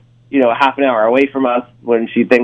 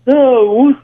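high, louder put-on Australian; fake Australian accent; 10 semitones higher, 4 dB louder than usual. Caller stalling before confessing to girlfriend, fake Australian accent. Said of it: "you don't actually have a, an Australian accent".

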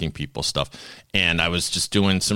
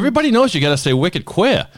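higher, louder playful punchline; jokey quip; 11 semitones higher, 4 dB louder, faster than usual. Acted. Host closing the bit, playful punchline. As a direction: toss off the punchline with Boston swagger.

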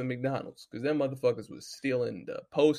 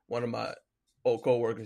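ordinary talk, nothing marked

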